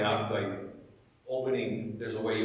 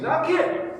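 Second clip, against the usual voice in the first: a mock Southern ask for a favour; imitating Southern Ron; 11 semitones higher than usual. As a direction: play the church member asking a favour in a Southern lilt. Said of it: "Ron's from the South".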